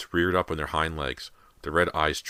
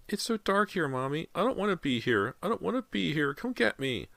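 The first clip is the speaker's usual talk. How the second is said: put-on scared child; 13 semitones higher than usual. A narrator's reading. Child voice pleading.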